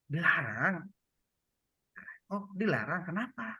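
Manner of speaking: soft echo lifting into a question; reflective questioning. Shown as a demonstration. Listener reflects a pregnant woman's words, gently questioning. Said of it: "pantulkan, nyambung".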